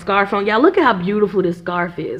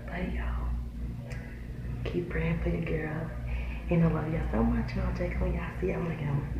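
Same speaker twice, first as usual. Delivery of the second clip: low, very soft murmur; hushed update; 4 semitones lower, 15 dB quieter than usual. Real, not acted. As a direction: talk quietly, as if not to be overheard. Murmured update from work bathroom.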